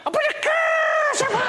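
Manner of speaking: shrieked high, witchy curse; shouted curse. Retold or imitated. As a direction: screech it like a witch. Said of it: "she shouted out".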